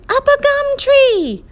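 very high, loud screech; piercing high bird cry; screaming bird. A narrator's reading. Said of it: "flew into the air and screamed".

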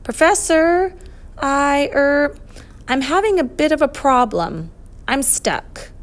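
high, halting call for help; hesitant plea. Acted. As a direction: own up to trouble, haltingly. Boy admitting he is stuck.

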